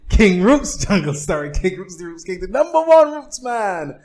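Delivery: high, hyped-up announcer intro; show announcer. Pretend. Host introducing himself as the Roots King, hyped announcer. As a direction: build to a big, high, loud finish.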